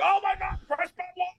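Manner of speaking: very high, soft squeal of a fan; mock awe. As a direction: imitate gushing excitement in a tiny high voice. Mimicking people's excited reaction to video.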